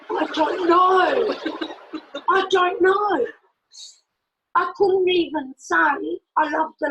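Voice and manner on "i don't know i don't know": high, loud, laughing protest of cluelessness; retold bafflement. Learner answering why she studies Spanish, baffled.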